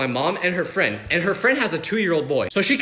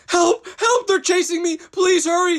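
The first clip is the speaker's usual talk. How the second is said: high, frantic mock cry for help; mock panicked caller; 11 semitones higher, slower than usual. Woman chased by a machete calling 911, mock panic. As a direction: play a terrified woman begging for help. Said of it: "on the nine one one call like".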